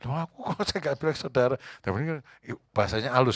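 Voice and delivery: usual speaking voice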